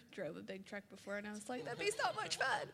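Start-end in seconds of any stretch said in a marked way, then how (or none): 1.7-2.7 high, bubbly excited voice; mock giddy, high; eager excitement. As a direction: gush like a thrilled girlfriend. Excited ask to drive truck.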